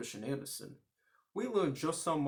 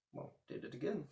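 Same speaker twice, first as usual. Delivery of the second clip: ordinary delivery, nothing marked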